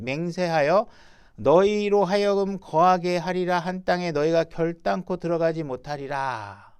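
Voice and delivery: loud, solemn Bible reading at full voice; scripture reading. Acted. Preacher reading God's oath to the congregation, loud and solemn. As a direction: proclaim the verse like a preacher. Reads God's judgment aloud.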